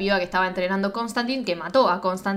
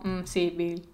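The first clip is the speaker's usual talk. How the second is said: low, soft, dismissive acting; noncommittal imitation; 3 semitones lower, 8 dB quieter, slower than usual. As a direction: imitate a halfhearted, vague recollection. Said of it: "para mí se lo dijo como". (como quien asiente sin ganas)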